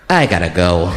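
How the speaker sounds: low, deadpan sign-off; resigned caller. Pretend. Caller hanging up on emergency line, low acted deadpan. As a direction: drop low to end the call.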